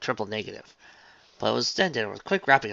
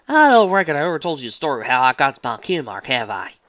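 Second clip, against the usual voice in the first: put-on Southern farm girl voice; 4 semitones higher, 10 dB louder than usual. A narrator's reading. Applejack voice, guilty.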